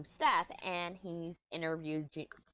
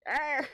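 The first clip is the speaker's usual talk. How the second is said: raised, throaty "argh"; mock growl of frustration; exasperated growl; 8 semitones higher, 4 dB louder than usual. Speaker acting out her reaction to her accent, growling.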